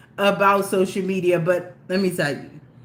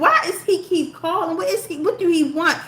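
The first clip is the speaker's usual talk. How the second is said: high-pitched retold annoyance at the calls; annoyed puzzlement, retold; 8 semitones higher than usual. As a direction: imitate her own irritated self, pitched up. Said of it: "I'm like".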